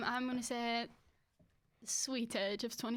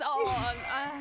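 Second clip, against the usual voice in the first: normal talking voice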